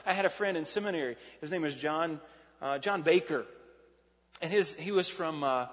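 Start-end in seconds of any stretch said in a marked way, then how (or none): none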